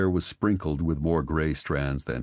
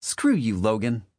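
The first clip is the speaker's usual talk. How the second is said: higher, louder spat insult; Marley, higher and sharp, telling him off; angry retort; 7 semitones higher, 3 dB louder than usual. Acted. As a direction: spit it at him.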